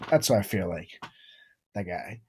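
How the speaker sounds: ordinary talk, nothing marked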